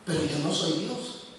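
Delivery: usual speaking voice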